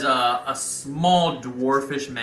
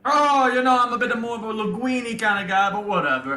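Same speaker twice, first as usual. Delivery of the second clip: put-on higher comic Italian accent; 11 semitones higher than usual. [name] turning down a donut, comic Italian accent. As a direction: shrug off the donut, Italian-style.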